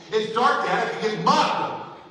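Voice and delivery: daughter protesting. Acted. Fourteen-year-old daughter objecting to walking home, indignant protest. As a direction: protest loudly, as if walking is unthinkable.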